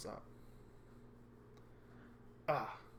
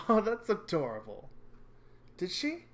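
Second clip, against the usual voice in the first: high gushing voice; 9 semitones higher, 10 dB louder than usual. Acted. Girl gushes over the story.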